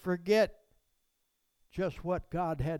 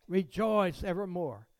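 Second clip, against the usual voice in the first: normal talking voice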